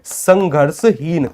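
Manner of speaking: संघर्षहीन drawn out syllable by syllable, very slowly; teacher spells out संघर्षहीन slowly for the class; slow answer. Shown as a demonstration. Teacher to students, slowly drawing out संघर्षहीन. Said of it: "कैसा होता है?".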